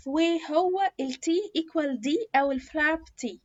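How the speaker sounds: ordinary talk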